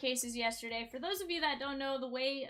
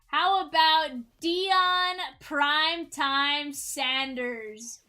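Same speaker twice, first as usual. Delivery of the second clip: hype reveal; 6 dB louder, slower than usual. Real, not acted. Card break hit reveal.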